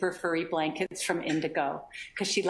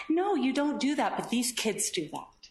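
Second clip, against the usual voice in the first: ordinary talk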